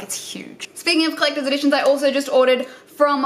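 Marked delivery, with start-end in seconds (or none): 0.0-0.7 awed whisper. Real, not acted. Awed by the book's size.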